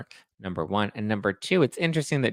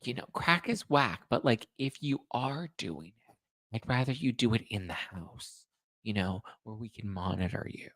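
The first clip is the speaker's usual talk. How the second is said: mock sweet mom; 7 dB quieter than usual. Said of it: "I'd be like".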